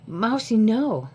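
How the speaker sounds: quick warning shout; sharp scolding call. Real, not acted. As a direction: snap at the pet to stop. Scolding cat knocking phone.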